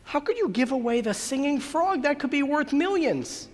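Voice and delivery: high-pitched disbelieving question; astonished, high-pitched challenge; incredulous. Acted. Manager incredulous at the man's giveaway.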